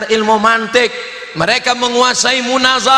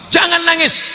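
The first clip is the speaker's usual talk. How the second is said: high, raised husband's order; firm command; 8 semitones higher than usual. Acted. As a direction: act out ordering the wife to stop crying. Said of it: "Saya kasih tahu istri". (perintah suami dengan suara tinggi)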